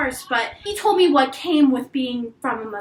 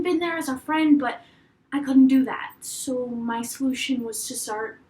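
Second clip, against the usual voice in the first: usual speaking voice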